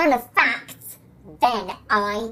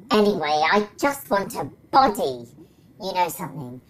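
ordinary delivery

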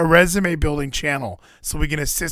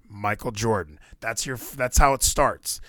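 ordinary talk, nothing marked

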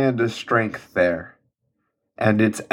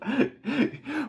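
high laugh; 9 dB quieter than usual. Real, not acted. Laughing with host.

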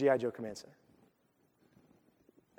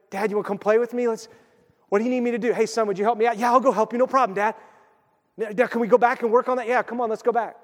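put-on eager, higher-pitched kid chatting with Dad; eager child; 6 semitones higher, faster than usual. Kid asking Dad to play, eager and high. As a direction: keep it bright-eyed and keen, pitched up.

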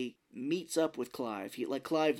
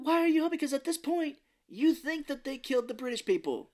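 very high, loud incredulous rant; exasperated outburst; 14 semitones higher, 6 dB louder than usual. Real, not acted. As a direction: protest the nonsense at full voice. Exasperated at the plot.

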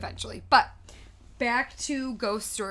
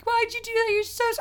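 very high, choked-up mock line; half-sob his lines up high; Titanic hero; 12 semitones higher than usual. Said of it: "he's like".

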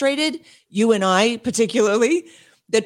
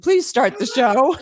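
laughing through the words; can't hold back a laugh while asking to begin; 3 semitones higher than usual. Real, not acted. Host laughing as she asks the guest to start the show. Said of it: "And I started laughing".

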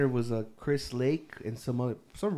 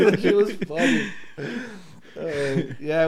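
laughing; 4 semitones higher, 4 dB louder, slower than usual. Real, not acted. Laughing retelling the theft.

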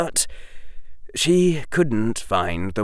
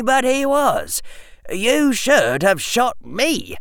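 sober, earnest schoolboy; 8 semitones higher than usual. Acted. Harry justifies the professor to Hermione, sober and insistent.

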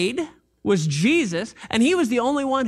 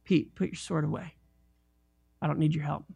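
soft rebuke; 6 semitones lower, 7 dB quieter than usual. Retold or imitated. Jesus voiced correcting Peter.

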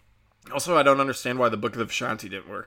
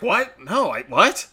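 mock shocked; 7 semitones higher, 7 dB louder, slower than usual. Strange shocked at the pin.

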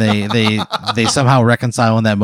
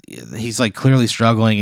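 ordinary talk, nothing marked

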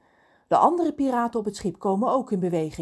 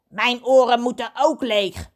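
loud, raised character voice; calling out; 5 semitones higher, 7 dB louder than usual. A narrator's reading. Kraai demands to have his ears cleared, calling out. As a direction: pipe up loudly with a demand. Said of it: "roept Kraai".

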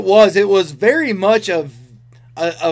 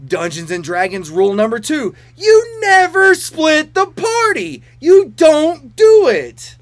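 mock wail, high and loud, slowing at the end; exasperated fan yelling at the screen in mock despair; exasperated rant; 4 semitones higher, 5 dB louder than usual. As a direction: plead like a frustrated player watching a bad move. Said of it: "I'm like".

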